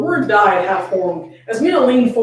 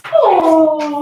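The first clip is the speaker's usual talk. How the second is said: higher, louder fond aww; 7 semitones higher, 5 dB louder than usual. Real, not acted. Co-host cooing at the sweet ending. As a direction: coo at the cute ending. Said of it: "reacting to sweet ending".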